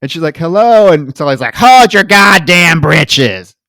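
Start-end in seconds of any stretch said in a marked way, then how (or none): 0.4-0.9 mock loud call. Miss Allen calling at door. 1.6-3.6 slow, higher, louder hammed-up gripe; mock grouchy yell. Sully snapping at visitor.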